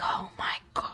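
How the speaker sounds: a voiceless, very soft "oh my God"; exasperated whisper. Retold or imitated. Student muttering after the coach's brush-off, whispered. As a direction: breathe it out under your breath in disbelief.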